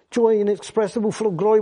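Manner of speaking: reaching for words that won't come; Peter straining for words. Retold or imitated. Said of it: "He's trying his hardest".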